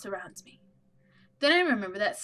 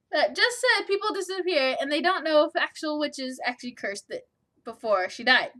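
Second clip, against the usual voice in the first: usual speaking voice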